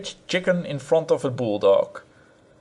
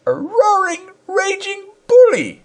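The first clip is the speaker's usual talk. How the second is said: high, upset scolding wife; furious wife; 5 dB louder than usual. Acted. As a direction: scold him near tears. Wife shrieks insult.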